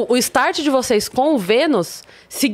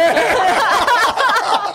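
high laugh; 10 semitones higher than usual. Real, not acted. Group laughter.

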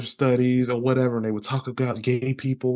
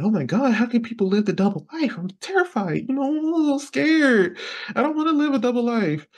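high, hammed-up fearful child; high mock fear; mock terrified; 13 semitones higher than usual. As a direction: act out the kid's fright, high and whiny. Young self scared, high voice.

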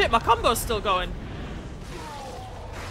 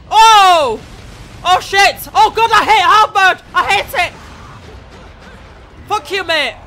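loud, high outburst of real frustration; frustrated shouting; 5 semitones higher, 7 dB louder than usual. Real, not acted. Gamer yelling at the hard-mode boss, frustrated shout. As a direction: yell it at the game. Boss fight going badly.

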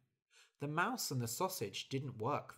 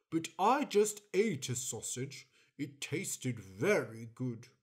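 smug dog; 5 dB louder than usual. Acted.

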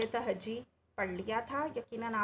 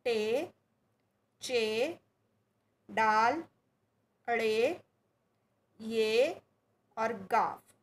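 each letter said alone, firm and a little louder, with long pauses; alphabet recitation for repeating; 4 dB louder, much slower than usual. Shown as a demonstration. Teacher reciting the Urdu alphabet to pupils, loud and deliberate. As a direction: call out each letter clearly so the children can echo it. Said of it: "कौन कौन से हैं?".

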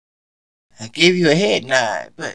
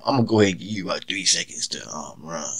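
low, fast, cold threat; deadpan threat; 10 semitones lower, 7 dB quieter, faster than usual. Acted. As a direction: rattle off the threat coldly.

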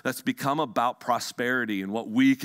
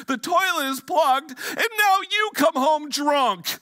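high, louder tearful rant building to a cry; 1 wailing wife; 12 semitones higher, 5 dB louder than usual. Retold or imitated. Pastor acting a wife listing her awful day, high crying rant. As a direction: spill every complaint in a wail. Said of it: "and she goes".

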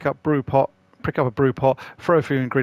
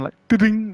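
high louder ding imitation; imitated ding; 9 semitones higher, 5 dB louder than usual. Imitating the satisfying ding he expected from the lid, higher and louder. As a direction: sing out the pan's ding. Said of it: "that kind of steel sound".

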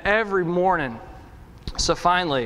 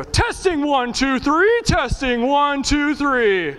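high, drawn-out mic test; sound guy mic check; 8 semitones higher, 3 dB louder, slower than usual. Retold or imitated. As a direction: imitate a sound guy testing the microphone. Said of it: "what every good sound guy does".

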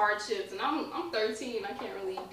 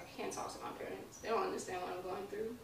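much softer, near whisper; quiet despair; 8 dB quieter than usual. Retold or imitated. Guest retelling her teenage despair, quietly. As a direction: say it as a private thought.

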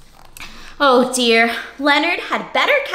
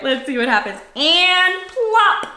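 ordinary delivery, nothing marked

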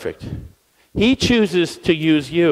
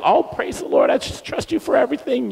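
mock pious; 7 semitones higher, 3 dB quieter than usual. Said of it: "Like you got all".

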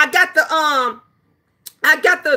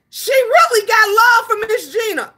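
excited shout; 9 semitones higher than usual. Real, not acted. Streamer bragging to chat about V S, excited shout. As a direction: shout it out with pride. Hyping love from V S.

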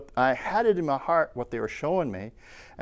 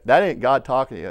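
usual speaking voice

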